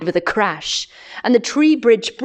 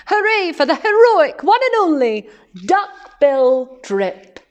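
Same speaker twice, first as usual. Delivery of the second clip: high, jubilant hoots, loudest at "Hooray"; high-pitched celebratory duckbill chant; hooting cheer; 7 semitones higher, 3 dB louder than usual. A narrator's reading. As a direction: hoot a hero's cheer, dropping for the final name. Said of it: "They hooted".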